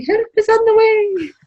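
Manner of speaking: high, loud, drawn-out call. Acted. Player calling as they run round the forge, high drawn-out shout. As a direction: sing out a mock rescue cry, slowly. Calling out in character while running.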